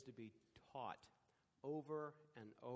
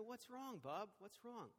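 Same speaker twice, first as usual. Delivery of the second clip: soothing grandfather; 6 semitones higher than usual. Retold or imitated. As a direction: coax a crying child to talk.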